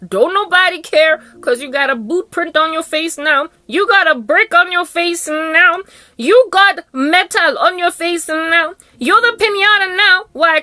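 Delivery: high, building taunt with stretched endings. Acted. Taunts perpetrators with rising repeats.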